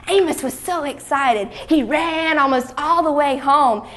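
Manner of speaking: stretched, high-pitched delight; excited narration. A narrator's reading. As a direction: tell it with a child's excitement. Narrator shares boy's excitement.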